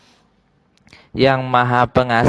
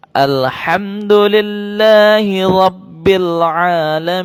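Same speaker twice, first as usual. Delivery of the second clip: Quran recitation; 3 semitones higher, 4 dB louder than usual. Shown as a demonstration. Teacher chants the verse for pupils to repeat. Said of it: "Satu kali lagi".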